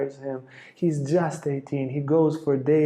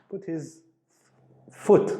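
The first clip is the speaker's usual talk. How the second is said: normal talking voice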